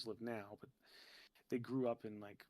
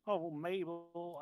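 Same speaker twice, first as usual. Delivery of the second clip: drawn-out rural South Jersey twang, higher and louder; grandma's rural twang; 5 semitones higher, 7 dB louder, slower than usual. Retold or imitated. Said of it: "she had this, like, twang".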